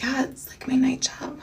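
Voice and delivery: whispered, hushed hint at a secret job; Dakota's whisper. Retold or imitated. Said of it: "that's how she talks".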